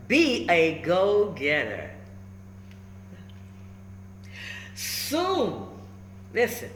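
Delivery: put-on lively, rhyming read-aloud for kids; storybook read-aloud. A narrator's reading. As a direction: bounce the rhymes like a picture book. Reads picture book aloud.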